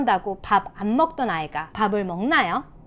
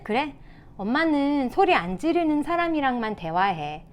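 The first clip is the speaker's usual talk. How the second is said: higher, even-tempered reply; composed mother; 4 semitones higher than usual. Shown as a demonstration. Mother to a yelling child, calm demonstration. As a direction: model mom keeping her cool. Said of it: "평정심을 유지해야".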